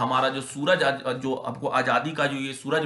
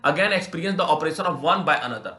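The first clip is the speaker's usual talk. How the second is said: reading the English passage slowly and deliberately; measured classroom read-aloud; slow text reading; 2 semitones higher, 3 dB louder, much slower than usual. Shown as a demonstration.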